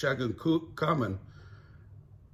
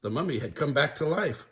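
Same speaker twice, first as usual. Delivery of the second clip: ordinary delivery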